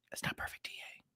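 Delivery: very soft whispered aside. Real, not acted. Streamer excusing his chart work to viewers, whispered. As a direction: whisper it under the breath. Repeats disclaimer to himself in whisper.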